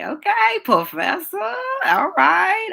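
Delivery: high, bright cheer; playful self-praise. Real, not acted. Teacher praising her own teaching, high and excited. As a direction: hype yourself up. Praising herself mid-class.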